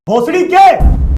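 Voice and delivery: loud stunned swear; shocked outburst. Real, not acted. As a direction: blurt it out in disbelief. Stunned reaction to clip.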